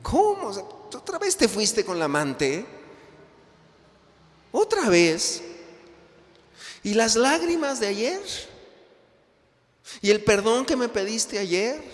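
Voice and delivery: high, loud outraged questioning; wife confronting her husband about his lover, high and loud; indignant wife. Acted. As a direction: fire back at the cheating husband.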